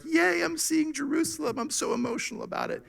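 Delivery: high, soft giddy mock weeping; mock happy crying. Mocking Jesus weeping for joy at the city. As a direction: gush like a giddy tourist. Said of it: "it's not a weeping like".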